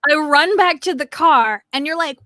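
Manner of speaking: normal talking voice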